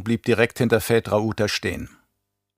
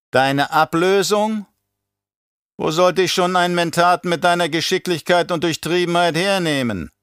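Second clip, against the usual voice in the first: schmeichelnder Baron; 7 semitones higher than usual. Acted. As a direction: flatter the Mentat in a raised voice. Baron flatters Peter. (hohe Baronstimme, schmeichelnd)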